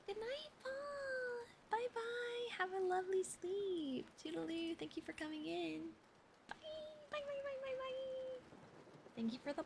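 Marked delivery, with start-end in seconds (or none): 0.1-8.4 much higher sing-song farewell; cheery goodbyes. Real, not acted. Streamer saying goodnight to leaving viewers, high cheery goodbyes. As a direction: wave the viewers off brightly.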